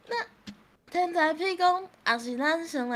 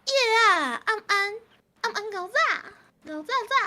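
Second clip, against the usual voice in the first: high, loud sing-out; playful calling out; 7 semitones higher, 5 dB louder, faster than usual. Pretend. Gamer calling out a night greeting to teammates, playful high call. Calls out nonsense greeting in game.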